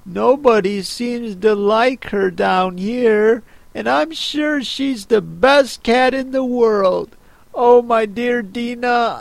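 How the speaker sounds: put-on a lonely girl missing her cat; mild sadness. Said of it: "in a sad tone".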